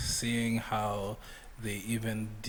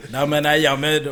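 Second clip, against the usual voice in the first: put-on loud, laughing Jamaican accent; 5 semitones higher, 14 dB louder than usual. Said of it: "The Jamaican accent is killing".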